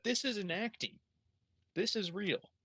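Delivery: high-pitched, dawning alarm; horrified realization. Retold or imitated. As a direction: pitch leaps up as the truth lands. Narrates horrified realization it is real.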